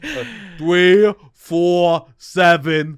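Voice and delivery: very slow, loud Staten Island number read; huge Staten Island accent. Shown as a demonstration. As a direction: drag out each digit in a thick Staten Island accent. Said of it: "put on a huge accent".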